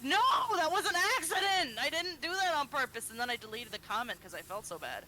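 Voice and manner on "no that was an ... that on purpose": high, pleading retold apology; mock panicked protest. Replying to a hurt commenter, retold as flustered pleading. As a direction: protest your innocence in a high voice.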